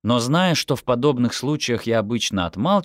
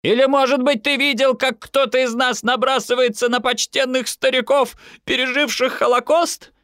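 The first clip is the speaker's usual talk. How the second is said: high-pitched indignant demand; mother's outraged tirade; relentless scolding; 3 dB louder than usual. A narrator's reading. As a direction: keep nagging, pitch held up high. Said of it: "твердила своё мама".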